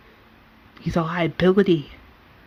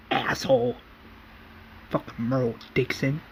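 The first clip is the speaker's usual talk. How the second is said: muttered curse; 8 dB quieter than usual. Retold or imitated. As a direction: whisper it as a retold aside. Retold curse after knocking Merle out.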